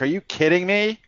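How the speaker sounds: acted-out astonishment, climbing in pitch; retold disbelief. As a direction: replay your stunned reaction to the news. Retelling his shock at deal size.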